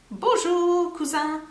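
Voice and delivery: high puppet bonjour; Jean puppet voice. Acted. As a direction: puppet greets his cousin. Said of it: "he says it's his cousin".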